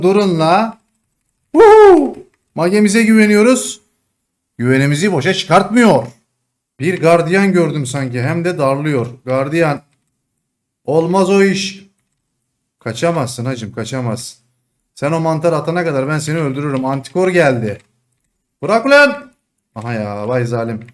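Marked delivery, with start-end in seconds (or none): 1.5-2.2 loud high whoop; excited cheer. Real, not acted. Excited cheer after a kill. 18.6-19.3 loud high yell at the enemy; shouting. Real, not acted. Yelling at enemy in game.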